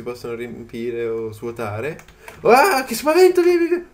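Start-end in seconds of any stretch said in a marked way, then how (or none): 2.4-3.8 high, loud panicked cry to get away; frightened shout. Real, not acted. Gamer ambushed by a wolf, high frightened shout. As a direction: shriek in fright and shoo it off. Attacked by creature.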